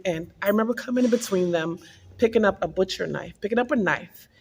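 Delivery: ordinary delivery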